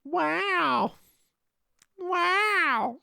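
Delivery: high drawn-out wow; Owen Wilson. Retold or imitated. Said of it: "you and Owen Wilson".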